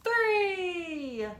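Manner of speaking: high, rising call of three; excited countdown. Acted. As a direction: launch it like liftoff. Countdown to launch in story.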